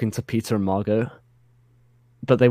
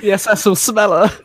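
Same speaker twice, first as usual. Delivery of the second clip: laughing shout; 12 semitones higher, 4 dB louder than usual. Acted. Joking shout.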